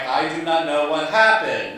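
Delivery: loud, every word of the denial separate; emphatic retort. Retold or imitated. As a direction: insist loudly, as if worn down by the question. Acted exasperated reply in the story.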